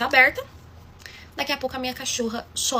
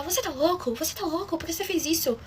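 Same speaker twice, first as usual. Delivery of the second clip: pai cobrando o filho, agudo; pai bravo retratado; 6 semitones higher, 5 dB quieter than usual. Retold or imitated. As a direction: imitando o pai indignado. (pai indignado imitado)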